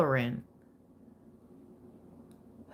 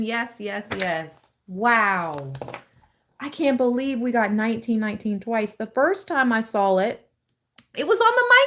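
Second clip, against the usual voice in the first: usual speaking voice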